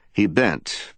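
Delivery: ordinary delivery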